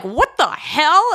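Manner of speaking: slow, drawn-out mock disbelief; a hint of exasperated disbelief; incredulous people. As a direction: act out the stunned reaction of others. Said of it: "And people are like".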